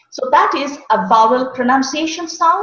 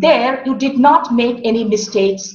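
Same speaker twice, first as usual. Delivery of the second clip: normal talking voice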